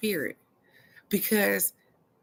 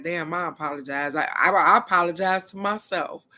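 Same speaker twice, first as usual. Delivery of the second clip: usual speaking voice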